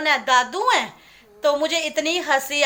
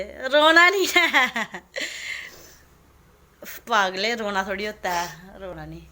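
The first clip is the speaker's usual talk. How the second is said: gentle consoling; 3 semitones lower than usual. Real, not acted. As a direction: hush her tears tenderly. Consoling a crying devotee.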